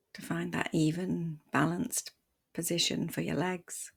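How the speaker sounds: soft, unhurried meditation voice with long pauses; guided meditation. Acted. Meditation teacher guiding listeners to settle the body, softly and calmly. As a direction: ease them gently into stillness, leaving space between phrases.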